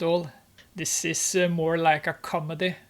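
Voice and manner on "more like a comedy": ordinary delivery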